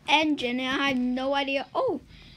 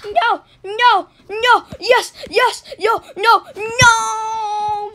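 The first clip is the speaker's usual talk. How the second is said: much louder, higher cries of no; panicked shouting; 7 semitones higher, 12 dB louder, slower than usual. Real, not acted. Gamer about to be caught, panicked shouts.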